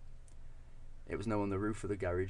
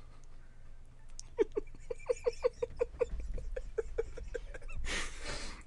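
laugh; 5 dB quieter than usual. Real, not acted. Laughter after his own joke.

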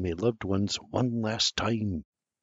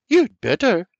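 higher, louder mock-stern mother; barks; 9 semitones higher, 5 dB louder than usual. A narrator's reading. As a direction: snap it playfully. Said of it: "she barks".

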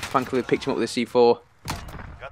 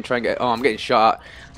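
ordinary talk, nothing marked

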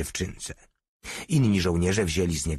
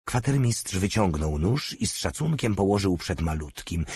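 usual speaking voice

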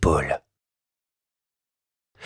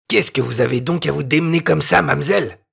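teasing soldier; 3 semitones higher than usual. A narrator's reading. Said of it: "lui dit-il".

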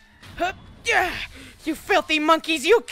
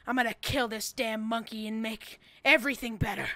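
dramatic monologue; 5 semitones lower, 5 dB quieter than usual. Acted. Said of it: "I was doing, like, a dramatic monologue".

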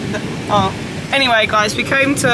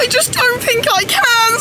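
high, slow, whiny crying voice; whiny impression; 3 dB louder than usual. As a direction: whine it like someone close to tears. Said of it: "I must be quite whiny".

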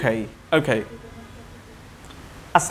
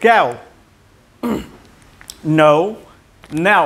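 American accent; 4 dB louder, much slower than usual. Shown as a demonstration. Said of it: "আওয়াজটা আসছে না".

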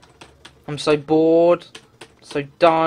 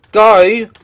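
sudden yell; 4 semitones higher, 12 dB louder than usual. Real, not acted. Rage at game design.

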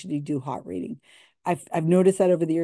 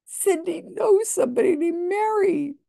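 much higher, louder sarcastic wonder; mock amazement; 13 semitones higher, 4 dB louder than usual. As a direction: play it as a fake big reveal. Mocking the psychic's reveal.

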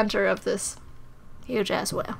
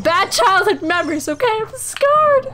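mock dramatic; 7 semitones higher, 3 dB louder than usual.

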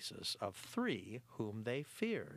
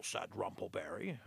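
ordinary delivery

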